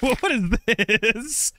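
drawn-out, wondering what is this; slow bemused query; slow puzzled question. Real, not acted. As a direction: stretch it out, baffled. Puzzled at odd game item.

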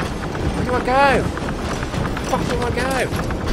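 lost and scared. Real, not acted. Lost and fleeing in the game.

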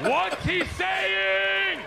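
high, drawn-out holler; insistent shout. Retold or imitated. Retelling demanding a translation from a stranger, slow shouting. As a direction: stretch every word into a loud demand.

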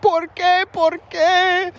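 very high, wailing mock grief; hammed-up sobbing widow. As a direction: wail "por qué" like a heartbroken widow. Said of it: "She's like".

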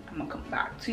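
quick whispered aside to viewers. Real, not acted. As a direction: whisper it off-mic as you step away. Steps away from camera briefly.

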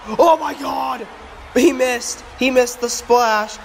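high, shouted disbelief; high-pitched call, slowing on the last phrase; excited play-by-play. Pretend. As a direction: cry out at a missed dive. Commentator reacts to missed dive.

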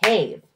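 put-on one firm beat for the kids to clap; single loud clapped syllable. Shown as a demonstration. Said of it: "Let's clap it".

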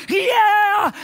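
high shouted yeah; excited yeah. Retold or imitated. Viewers shouting back at the host, high and excited. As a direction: yell it like a fan who can't wait. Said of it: "We're like".

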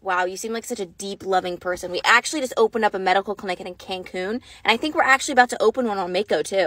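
low, soft admiring guy. Retold or imitated. Doctor at the club flattering the woman, low and soft. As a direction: play the charmed doctor, low and gentle. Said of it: "He's like".

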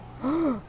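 sharp, high gasp; startled gasp. Real, not acted. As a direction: gasp in sudden surprise. Startled while playing the game.

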